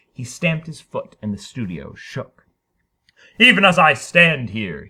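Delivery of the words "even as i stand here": loud bellowed defiance; bellowing. Acted. Harrison bellowing at the studio crowd. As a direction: bellow it at the room. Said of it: "he bellowed".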